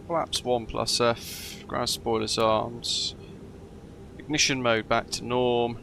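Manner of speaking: ordinary delivery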